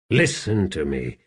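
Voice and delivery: ordinary delivery, nothing marked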